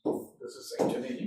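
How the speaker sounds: unvoiced murmur to himself; soft whisper while writing. Shown as a demonstration. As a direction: whisper as you write it on the board. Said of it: "diastole नहीं होगा".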